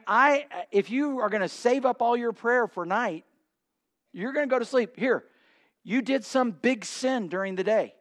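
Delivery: ordinary talk, nothing marked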